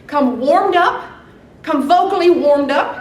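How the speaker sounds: slow, emphatic audition call; slow drawn-out announcement. Acted. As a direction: stretch it out like an announcer. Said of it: "audition call to everyone".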